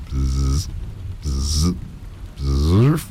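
buzzing bee imitation. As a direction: buzz like a bee at the window. Imitation at dinner.

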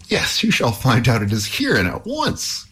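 high-pitched, defiant retort; defiant cry. A narrator's reading. Halvor answers the troll from behind the door, defiant cry. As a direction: cry it out high, without shouting. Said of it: "cried Halvor".

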